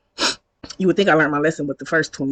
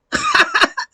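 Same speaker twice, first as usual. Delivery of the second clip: sudden high-pitched laughter; real amused cackle. Real, not acted. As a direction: burst out laughing at the absurd answer. Laughs at his one-word answer.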